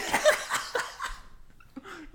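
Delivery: high, breathless cackle; real burst of laughter. Real, not acted. Friend cracking up at the sandball scene, high airless laugh. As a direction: laugh hard, high and airy.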